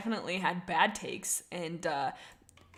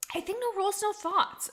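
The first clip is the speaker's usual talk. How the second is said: excited; 12 semitones higher than usual. Real, not acted. Verdict on Blossom Fall.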